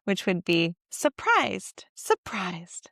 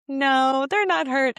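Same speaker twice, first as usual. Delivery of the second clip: playful; 5 semitones higher, 5 dB louder, slower than usual. Acted. Mother answering in toddler talk.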